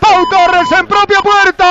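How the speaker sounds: high, slowed excited own-goal shout. Real, not acted. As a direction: shout the scorer's name. Naming own-goal scorer.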